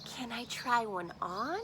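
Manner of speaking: normal talking voice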